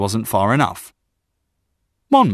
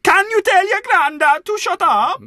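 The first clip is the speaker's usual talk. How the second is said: shouted, very high sing-song Welsh; thick Welsh accent; 4 dB louder than usual. Acted. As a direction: yell it in exaggerated Welsh. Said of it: "He said in a thick Welsh accent".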